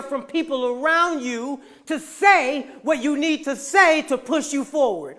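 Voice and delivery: loud, high, building exhortation; rousing motivational sermon delivery; preaching at full force. Acted. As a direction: preach it hard, hammering the key words.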